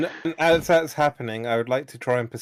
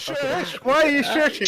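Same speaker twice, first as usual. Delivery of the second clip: high, offended in-character voice; high indignant voice. Acted. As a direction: protest being shushed in a high voice. In-character reply to shushing.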